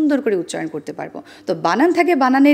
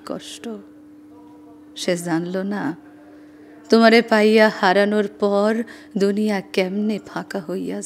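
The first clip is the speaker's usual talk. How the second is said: slow, aching recitation with long pauses; poem recitation; slower than usual. Shown as a demonstration. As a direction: recite as a lament for lost love. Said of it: "আমি সেটা একটু শোনাতে চাই".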